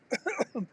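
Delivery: sudden harsh cough; coughing fit. Real, not acted. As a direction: cough out a swallowed bug. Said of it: "Pardon me, swallowed a bug".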